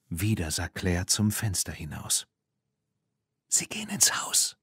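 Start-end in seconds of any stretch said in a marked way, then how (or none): 3.5-4.6 urgent hushed whisper. Acted. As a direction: whisper a lookout's report. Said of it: "wisperte sie".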